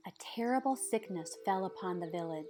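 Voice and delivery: plain reading voice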